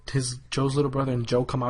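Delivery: usual speaking voice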